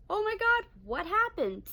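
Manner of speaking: alarmed mother, high voice; shocked mom. Retold or imitated. Mom sees bloodied face.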